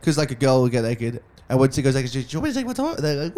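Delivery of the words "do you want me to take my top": mock flirty question pitched way up; a dancer teasing the crowd, voiced high and coy; imitating a stripper.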